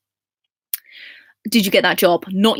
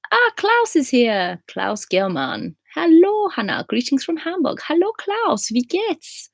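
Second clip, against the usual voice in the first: high, singsong delighted hello; high-pitched cheery welcome; excited greeting; 10 semitones higher, slower than usual. Real, not acted. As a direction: greet a favourite regular, pitched way up. Greeting a regular who arrives.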